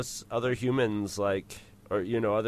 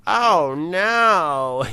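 higher, louder droid yelp; droid panic; 7 semitones higher, 8 dB louder than usual. Retold or imitated. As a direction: squeal it like a frightened droid. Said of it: "there's not a lot of space for".

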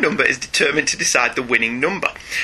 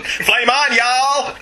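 mock Kentucky accent; 8 semitones higher, much slower than usual. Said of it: "Was he from Kentucky all of a sudden?".

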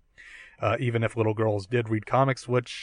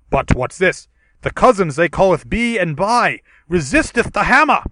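booming Shakespearean hero, louder; archaic heroic voice; 3 semitones higher, 7 dB louder than usual. Acted. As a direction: declaim like a noble thunder god. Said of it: "we can do a dramatic reading".

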